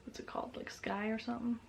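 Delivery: unvoiced whisper, searching memory; whispered to self. Real, not acted. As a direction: whisper it to yourself. Whispered to herself.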